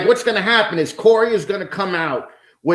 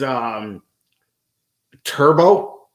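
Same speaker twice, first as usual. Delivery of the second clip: ordinary talk, nothing marked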